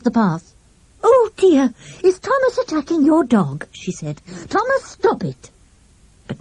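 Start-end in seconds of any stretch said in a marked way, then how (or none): 1.0-3.7 high, flustered alarm; worried lady. A narrator's reading. Plump lady runs out worried about the dogs, high and flustered. 4.5-5.5 high sharp order to the dog; commanding the dog. A narrator's reading. Lady orders her dog to stop fighting, high sharp command.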